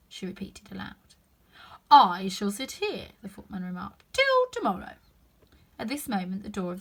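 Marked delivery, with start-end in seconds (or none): 1.9-3.1 stolid footman. A narrator's reading. 4.1-5.0 stolid footman. A narrator's reading.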